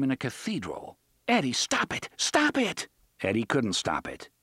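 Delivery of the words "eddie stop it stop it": high, urgent pleading cry; dismayed cry. A narrator's reading. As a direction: beg him to stop, aghast. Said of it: "the dismayed expression of a devout woman".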